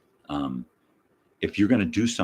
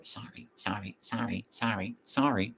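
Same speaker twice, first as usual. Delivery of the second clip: soft, higher repeated sorries; heard across the store; 5 semitones higher, 6 dB quieter than usual. Retold or imitated. Shopper pushing through the store, heard from afar, soft mock apologies. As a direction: imitate a distant, repeated little apology. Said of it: "I can hear them throughout the store".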